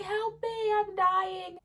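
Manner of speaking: high, panicked plea for help; freaking out. Retold or imitated. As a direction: beg like you think you're dying. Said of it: "she starts freaking out".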